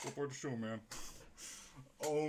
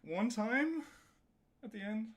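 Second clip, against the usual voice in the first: much higher pitched rising questions; incredulous questioning; 10 semitones higher than usual. Real, not acted. Amazed at own run.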